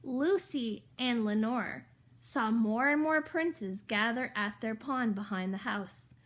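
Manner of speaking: ordinary delivery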